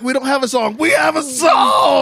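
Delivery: a hammed-up girlfriend insisting; mock gushing. Co-host imitating a girlfriend gushing over their song. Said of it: "She's like".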